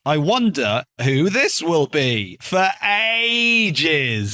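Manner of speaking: slow, exaggerated suspense, rising high on the last words; hammed-up curiosity, stretching AGES long and high; mock wondering. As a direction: drag it out in sarcastic wonder. Mock suspense at an obvious reveal.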